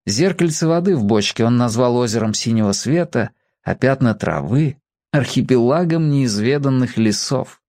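ordinary delivery, nothing marked

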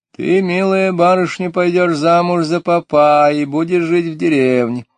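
put-on old nasal fortune teller's voice. A narrator's reading. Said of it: "старческим, гнусавым голосом гадалки".

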